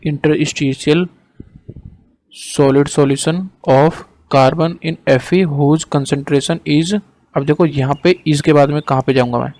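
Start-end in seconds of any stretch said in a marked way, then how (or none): 0.1-6.9 slow, measured dictation; word by word, with pauses for writing. Real, not acted. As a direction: dictate slowly for note-taking. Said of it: "note कर लो जो मैं बोल रहा हूँ".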